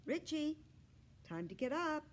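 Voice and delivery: high, calling mother's voice; mom calling out. A narrator's reading. Mom calling her son to get up, high and carrying. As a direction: call him up for school like a mom. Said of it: "she called out".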